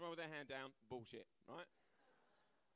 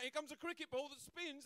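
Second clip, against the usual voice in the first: mock proud Brit; 9 semitones higher, 3 dB louder than usual. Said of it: "We're like".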